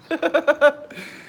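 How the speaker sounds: high bursting laugh at her own nerves; real laugh. Real, not acted. Laughing off her nerves to the live chat. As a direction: laugh out the stress.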